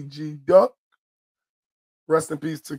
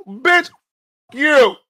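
angry retort; 6 semitones higher, 6 dB louder than usual. Retold or imitated. Retort on mother's behalf.

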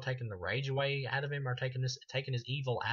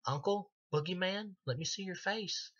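put-on little girl voice; 7 semitones higher than usual. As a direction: mimic a small child begging her uncle. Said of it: "she's like".

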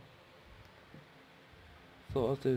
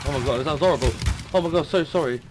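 horrified; 5 semitones higher, 6 dB louder, faster than usual. Real, not acted. Horrified reaction in battle.

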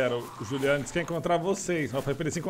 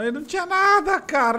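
exasperated outburst; 12 semitones higher, 5 dB louder than usual. Real, not acted. Frustrated gamer outburst.

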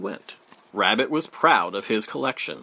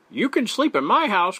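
raised, higher beaver voice; beaver calling out; 8 semitones higher, 4 dB louder than usual. A narrator's reading. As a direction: call out the offer as a friendly beaver. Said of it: "called Beaver".

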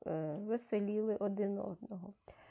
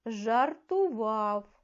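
drawn out slowly, word by word; slow syllable reading; 4 dB louder, much slower than usual. Shown as a demonstration. Teacher models slow reading of a word, slow and clear. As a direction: read it slowly for the class to follow. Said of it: "Наступне речення".

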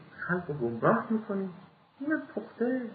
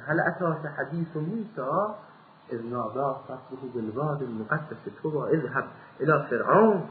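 Quran recitation; 4 semitones lower than usual. Acted. Quran verses recited in sermon.

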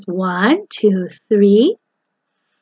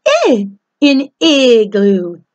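ordinary talk, nothing marked

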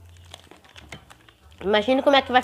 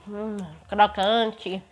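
talking while chewing chocolate; savouring a mouthful; chewing with mouth full; 3 semitones lower, 7 dB quieter, much slower than usual. Real, not acted. Seen 1: Bit chocolate at -7; eyes closed, jaw moving while savouring.

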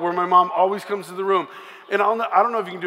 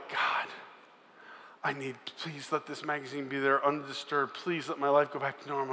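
soft, hushed plea; desperate prayer; 3 semitones lower, 9 dB quieter than usual. Retold or imitated. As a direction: pray quietly and desperately. Praying at his door.

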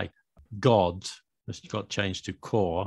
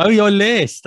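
high, loud burst of realization; sudden realization; 12 semitones higher, 13 dB louder, slower than usual. Real, not acted. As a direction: exclaim it as the penny drops. Suddenly catches what was meant.

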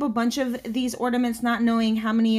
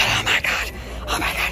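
awed whisper. Real, not acted. Amazed at a rare find.

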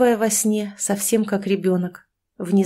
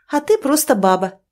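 harsh retort; 6 semitones higher, 4 dB louder than usual. A narrator's reading. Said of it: "жёстко парировала Катя".

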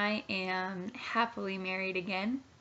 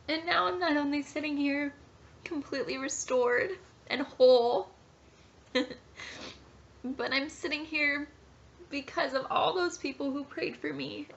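higher, tearful voice close to breaking; emotional, near tears; 5 semitones higher than usual. Real, not acted. Pregnant mother telling viewers her story, tearful and higher. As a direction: talk through real tears, holding it together. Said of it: "I'm emotional because".

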